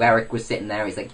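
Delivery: usual speaking voice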